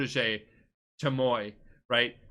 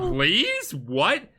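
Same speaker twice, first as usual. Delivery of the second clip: sassy disbelief; 7 semitones higher, 4 dB louder than usual. Retold or imitated. Yuna's scornful retort quoted.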